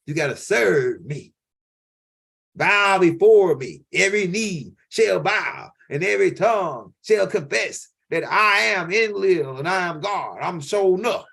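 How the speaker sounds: booming slow god proclaiming; megalomaniac rant. Acted. Voicing the god Enlil.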